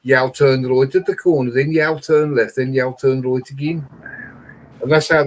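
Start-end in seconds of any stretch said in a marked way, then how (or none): 0.0-3.9 lightly put-on Brummie directions; playful mock Midlands accent. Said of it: "They talk like this".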